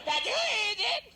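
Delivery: high, loud, drawn-out yell; furious slow shout. Acted. Plumber banging on the door yells slow and high. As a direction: drag out the yell in fury.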